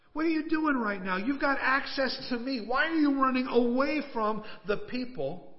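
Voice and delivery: high, stretched-out urgent questioning; pleading challenge. Retold or imitated. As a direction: voice God pressing a runaway with questions. Voices God questioning Elijah.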